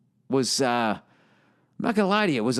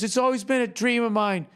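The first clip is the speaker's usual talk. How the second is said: higher, gushing fan voice; starstruck fan; 9 semitones higher than usual. Retold or imitated. Fan tells the comic his dream, gushing and higher. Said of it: "He goes".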